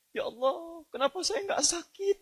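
high, soft imitated wail to God; retold tearful plea. Ulama laments to God that he stayed healthy, imitated tearful plea. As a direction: plead tearfully in a high, soft mock cry. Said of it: "dia nangis, Pak".

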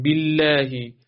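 باللهی with a held, doubled ل; doubled lam demo. Shown as a demonstration. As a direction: lean on the ل in باللهی. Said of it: "صفت توسط حرف لام مشدد رو رعایت بکنید".

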